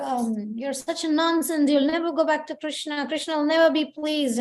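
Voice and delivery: high, heated scolding of a partner; acted angry outburst. Teacher acting out a furious partner in passion mode, high angry rant. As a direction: blow up at them in a rage, pitched high. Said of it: "lose temper, lose control and say".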